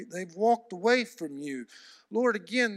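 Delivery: normal talking voice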